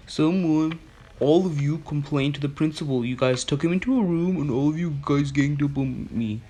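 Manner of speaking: high, loud, put-on whine of complaint; imitated aggrieved teacher. Student mimicking teacher who felt ganged up on, high aggrieved voice. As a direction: mimic a teacher loudly playing the victim. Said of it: "But she's like".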